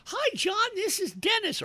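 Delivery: squeaky mock greeting; campaign texter. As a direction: parody a canvasser in high falsetto.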